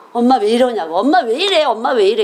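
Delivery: put-on repeated, insistent child's protest; protesting children. Children watch mom washing bedcovers by foot, protesting. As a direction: imitate kids pleading with mom in rising swoops. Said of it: "그럼 우리 애들이".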